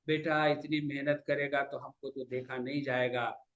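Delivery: low, soft motherly concern; hushed, pained plea; worried mother. Retold or imitated. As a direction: speak gently to your son, voice dropped. Voices his worried mother tenderly.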